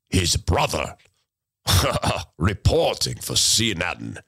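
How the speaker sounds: low, sinister newsreader; mock CNN reporter voice. Mocking CNN as a sinister low-voiced reporter. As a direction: spoof a creepy TV reporter. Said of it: "They are in the C N N voice".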